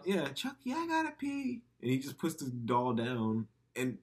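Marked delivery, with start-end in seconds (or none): 0.1-1.6 high-pitched little kid imitation; piping mock child voice; little boy Andy. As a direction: mimic a small boy talking to his doll. Acting young Andy to doll.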